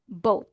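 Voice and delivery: "both" said as "boat", falling; "both" as bow plus T, pitch dropping; final T sound. Shown as a demonstration. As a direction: say "both" with a T instead of TH. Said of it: "bow na may T".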